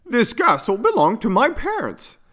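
high-pitched storybook character; young unicorn voice. A narrator's reading. Yuno tells friends about the parents' castle, high character voice.

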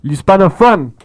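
loud mock coach with Haitian-tinged accent; hammed-up manager, Haitian lilt; Haitian-sounding accent. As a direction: call out orders in a rough Haitian accent. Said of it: "un petit accent haïtien".

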